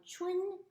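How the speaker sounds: "Chun" high and level; first tone. Shown as a demonstration. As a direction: hold "Chun" on one high note. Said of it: "first tone".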